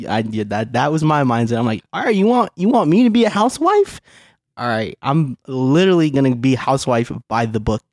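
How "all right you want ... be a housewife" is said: high, incredulous retort; mock indignant challenge. Husband retelling his mindset toward his working wife, indignantly defiant. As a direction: throw it back as a challenge, pitch jumping up.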